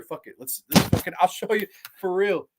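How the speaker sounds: normal talking voice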